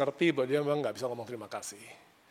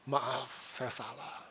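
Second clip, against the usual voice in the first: soft, slow and humble, much quieter; a wife finally apologizing with humility, voice dropped low; quiet, slow and humble admission of fault; humble apology; 5 semitones lower, 10 dB quieter, much slower than usual. Retold or imitated. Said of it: "dengan rendah hati dia katakan".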